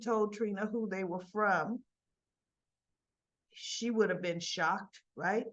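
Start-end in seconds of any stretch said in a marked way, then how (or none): none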